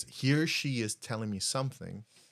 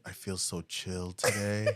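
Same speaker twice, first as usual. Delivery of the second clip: mock chill; 5 semitones lower, 5 dB quieter than usual. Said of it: "I was like".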